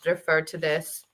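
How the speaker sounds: ordinary talk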